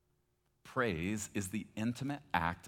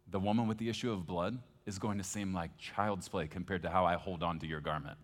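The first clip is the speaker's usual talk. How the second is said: normal talking voice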